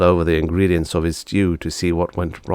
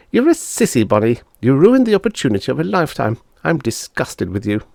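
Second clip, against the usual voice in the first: put-on high scornful boy; a little boy sneering; boy's voice; 6 semitones higher than usual. Bob scolding his sister, high scornful voice. Bob's lines.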